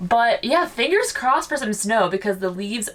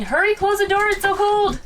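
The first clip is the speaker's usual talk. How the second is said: urgent high cry; 11 semitones higher, 3 dB louder than usual. Real, not acted. Cold air rushing in.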